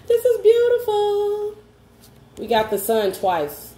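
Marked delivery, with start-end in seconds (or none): 0.0-1.5 much higher and louder, slow and stretched out; delighted gasp. Real, not acted. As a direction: gush over the card in a high, drawn-out voice. Delighted at the card drawn.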